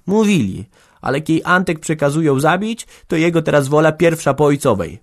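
stern, insistent outburst; sharp, unyielding. A narrator's reading. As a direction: lay down the law sharply. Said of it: "podniosła ostry, nieustępliwy głos".

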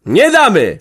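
loud, high shout of refusal; a sudden shout of no; booming retort. A narrator's reading. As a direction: boom it out from the crowd. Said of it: "huknął ktoś".

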